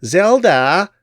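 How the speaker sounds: high, loud shriek of a name; screaming call. Acted. Crone screaming for Zelda. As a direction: scream for her accomplice. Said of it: "she screamed".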